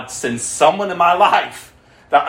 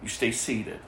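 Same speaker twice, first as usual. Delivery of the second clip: hushed order; 10 semitones lower, 13 dB quieter than usual. Retold or imitated. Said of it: "He's like".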